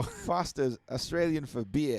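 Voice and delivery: slow ad-slogan Australian; Australian accent. Acted. Co-host showing off an accent, slow put-on Australian. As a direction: drawl it like an Australian beer advert. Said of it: "There's a Mexican Australian here".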